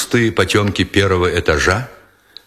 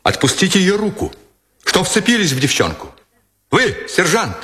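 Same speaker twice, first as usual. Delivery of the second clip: higher sharp order; heated command; 8 semitones higher than usual. A narrator's reading. Lieutenant ordering the sergeant to release the girl, heated command. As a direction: command him to let go, still hot. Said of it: "скомандовал Никитин неостывшим голосом".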